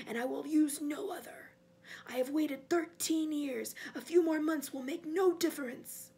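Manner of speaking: cold, imperious resolve; commanding master. Acted. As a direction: deliver a measured threat to the servant. Cold master issues a threat.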